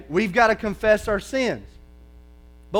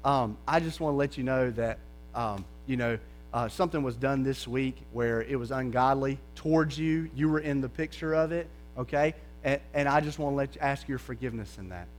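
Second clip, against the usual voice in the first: much softer and lower, gentle confession; soft sincere apology; 6 semitones lower, 8 dB quieter than usual. Shown as a demonstration. Pastor models a soft apology to a sister in Christ. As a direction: quietly ask a sister's forgiveness.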